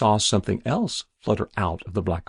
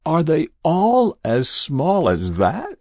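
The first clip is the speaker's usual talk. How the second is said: slow, high, strained question; hoarsely; 8 semitones higher, 6 dB louder, slower than usual. Acted. Jabez asking about the tiny souls, hoarse. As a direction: force out the dreadful question. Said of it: "he asked hoarsely".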